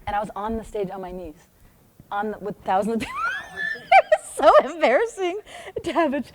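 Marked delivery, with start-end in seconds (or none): 3.0-6.3 laughing through the words. Real, not acted. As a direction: giggle out the embarrassment. Laughing at embarrassing memory.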